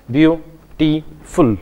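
"beautiful" slowly as beau, ti, ful, with gaps; split into parts. Shown as a demonstration. Teacher explaining syllable splitting in Hindi, "beautiful" said part by part. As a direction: break "beautiful" into three distinct syllables. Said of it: "इसको pronounce कर सकते हैं parts में".

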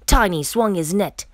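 ordinary delivery, nothing marked

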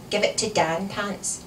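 ordinary delivery

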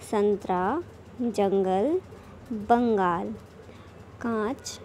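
each word said alone with a pause, nasal sounds clear; read-aloud word list. Shown as a demonstration. Teacher reads the word list aloud for children to repeat. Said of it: "बोलकर पढ़िए".

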